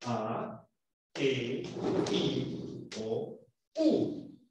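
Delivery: A, E, I, O, U each held, soft and low; vocales largas. Shown as a demonstration. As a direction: sustain A, E, I, O, U one by one. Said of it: "vocales largas". (A, E, I, O, U largas, en voz baja)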